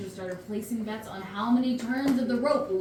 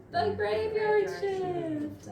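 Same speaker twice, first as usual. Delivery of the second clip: drawn-out dramatic reveal; slow punchline; 8 semitones higher, much slower than usual. Acted. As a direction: stretch it out for the pun. Punchline delivered slowly.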